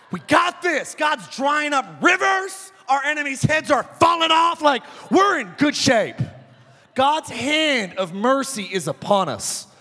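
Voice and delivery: rally the troops; hyped warriors. Retold or imitated. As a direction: warriors shouting with adrenaline. Pumped warriors crossing river.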